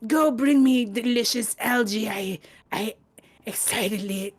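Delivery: pompous creature lord, building in pitch; giant snail lord. Acted. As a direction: proclaim like a pampered god to worshippers. Game master voices giant snail lord.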